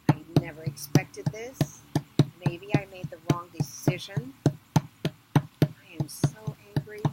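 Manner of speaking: loud, slowing angry outburst. Acted. Inanna rages to herself on the path, loud and slowing. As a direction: let the anger boil over.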